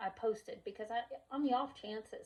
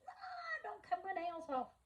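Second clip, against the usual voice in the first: put-on pleading child; 11 semitones higher than usual. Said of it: "getting out the fingernail clippers".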